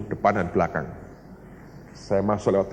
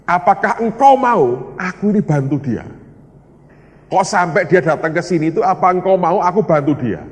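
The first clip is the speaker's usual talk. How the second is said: raised, higher-pitched earnest question; pleading with God; 7 semitones higher, 7 dB louder than usual. Retold or imitated. Preacher asking the Holy Spirit whether to help, pleading loudly.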